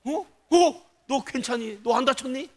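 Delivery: acted high-pitched startled concern; concerned parent. Parent asking first about the child's safety, acted alarm. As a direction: check urgently that your child is unhurt. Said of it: "자식의 안위를 먼저 물어본다는".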